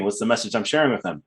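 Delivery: usual speaking voice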